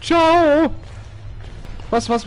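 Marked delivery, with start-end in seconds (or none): 0.0-0.7 high, loud startled cry; startled yelp. Real, not acted. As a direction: jump out of your skin. Startled by the monster.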